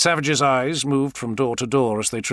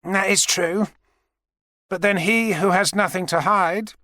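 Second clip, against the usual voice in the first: higher-pitched, measured public speaker; television interviewee; 9 semitones higher than usual. Acted. A guest answering an interviewer on television, measured. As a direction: answer like a public figure on air.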